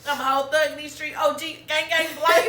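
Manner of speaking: loud, high, hammed-up gang talk; laughing mock thug brag. Joking with friends about being a thug, laughing loud brag. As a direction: play the hard gangster while cracking up.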